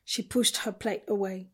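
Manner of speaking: ordinary delivery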